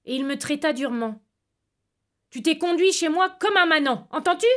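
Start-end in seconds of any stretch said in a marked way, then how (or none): 2.3-4.5 louder, higher angry reproach; a stern uncle's angry rebuke, louder and higher; furious uncle. Acted. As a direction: scold him hard. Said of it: "pâle et furieux".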